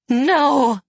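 high, loud anguished wail; sobbing cry. Acted. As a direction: scream it through tears. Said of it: "she sobbed".

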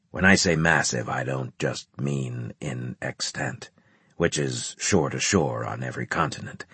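low, quiet officer voice; brief the officers low and measured; grave commander briefing. A narrator's reading.

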